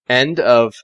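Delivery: ordinary delivery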